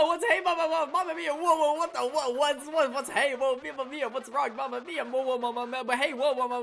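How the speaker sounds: host freaking out over a strange creature; frantic host babble. Acted. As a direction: sputter in shock like a cartoon host. Reading the host's frantic lines.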